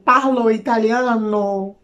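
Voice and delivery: slow, lightly hammed-up Italian; a hint of Italian accent; mock Italian. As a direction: imitate an Italian showing off his Italian. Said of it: "italiano falando italiano".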